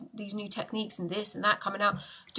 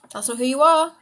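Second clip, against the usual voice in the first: louder, firm and insistent; emphatic encouragement; 5 semitones higher, 9 dB louder than usual. Real, not acted. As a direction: push it home with force.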